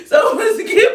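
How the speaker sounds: loud, laughing exclamation; excited shout. Real, not acted. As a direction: blurt it out with glee. Building to the punchline.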